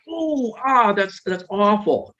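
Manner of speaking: high, slow, exaggerated alarm; overanxious parent. Anxious parent fussing over a hurt child, high slow alarm. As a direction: overdo the worry like a fussing parent. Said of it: "a little too much".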